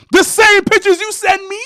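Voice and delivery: high, loud indignant question; outraged question. Retold or imitated. Boyfriend confronting girlfriend over pictures, high and outraged. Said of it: "so I go".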